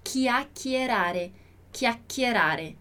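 "chiacchierare" slowly as "kia-kie-ra-re"; pronunciation demo. Shown as a demonstration. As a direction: sound out "chiacchierare" slowly with hard k's. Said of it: "A pronúncia seria isso". ("chiacchierare" devagar, com som de qui)

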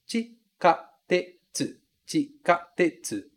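"Chikatetsu" slowly, chi-ka-te-tsu, four clear syllables; slow syllable drill. Shown as a demonstration. Teacher drills students slowly on "Chikatetsu". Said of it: "São quatro sílabas".

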